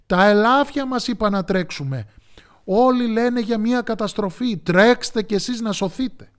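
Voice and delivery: high, loud animated alarm; urgent warning. A narrator's reading. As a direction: cry out for everyone to flee. Pigs warn of catastrophe.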